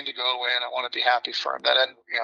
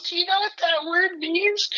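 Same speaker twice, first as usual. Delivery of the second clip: much higher, slow, scandalized mom voice; imitating mom. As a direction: play the shocked mother asking slowly. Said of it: "my mom goes".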